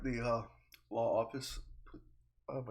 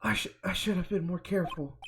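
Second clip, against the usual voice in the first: higher-pitched, lightly rueful heroine; regretful woman's voice; 5 semitones higher, 5 dB louder than usual. Acted. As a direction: stammer slightly, admitting a mistake. Voices cornered Miss Fay.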